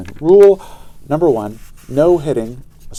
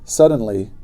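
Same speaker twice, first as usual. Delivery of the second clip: ordinary delivery, nothing marked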